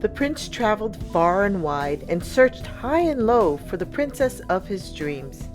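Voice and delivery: ordinary delivery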